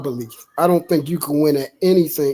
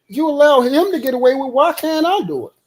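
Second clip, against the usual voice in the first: much higher, fast complaining voice; whiny other man; 9 semitones higher, faster than usual. Retold or imitated. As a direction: whine like a jealous man. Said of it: "other man gonna be like".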